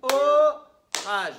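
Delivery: "Orage" as two loud, stretched beats, "o... rage"; two syllables. Shown as a demonstration. Teacher showing the class two syllables, split loudly. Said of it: "Deux syllabes".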